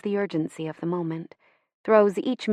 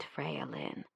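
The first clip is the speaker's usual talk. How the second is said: name said very softly; a near-silent fond naming; soft motherly address; 3 semitones lower, 16 dB quieter than usual. Retold or imitated. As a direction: hush the name down almost to nothing. Mother's advice remembered.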